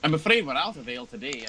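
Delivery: apologetic barmaid. Acted.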